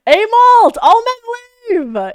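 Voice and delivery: very high, very loud yell; loud meme exclamation; meme shout. Pretend. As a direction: belt the word out like a war cry.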